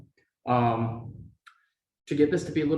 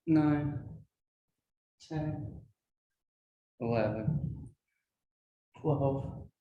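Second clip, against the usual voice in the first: counting reps mid-row, under effort; doing rows; 3 dB quieter, slower than usual. Real, not acted. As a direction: count while rowing with a flat back. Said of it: "upright rows where you hinge at the hips".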